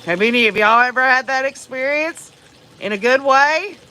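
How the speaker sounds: ordinary talk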